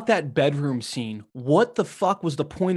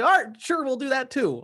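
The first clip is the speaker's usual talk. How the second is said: higher-pitched, mock easygoing going along; aw-shucks agreeable; 7 semitones higher than usual. Podcaster mocking a character who goes along with plans, aw-shucks agreeable. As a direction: imitate a guy shrugging along with everything. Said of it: "he just kind of all shucks his way".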